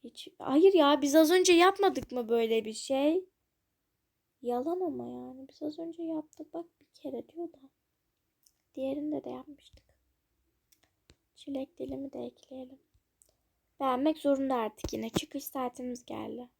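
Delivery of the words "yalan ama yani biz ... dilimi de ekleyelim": soft whisper to herself; whispered asides. Real, not acted. Vlogger focused on making coffee, whispering. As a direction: hush it while concentrating. Muttering while playing.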